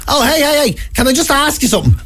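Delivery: high-pitched over-friendly street approach; smiling stranger. Retold or imitated. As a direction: hail a passer-by with a high, smiley voice. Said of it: "smiling strangers that go".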